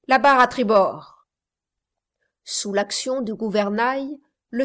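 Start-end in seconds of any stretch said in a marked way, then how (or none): 0.1-1.3 command. A narrator's reading. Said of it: "dit-il".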